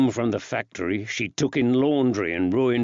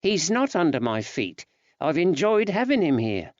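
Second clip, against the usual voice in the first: woman's voice; 7 semitones higher than usual. Acted. Betsy reassuring husband.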